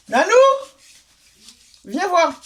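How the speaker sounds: loud call to the next room; yelling across the room. Real, not acted. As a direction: shout out to someone far off. Calling someone from another room.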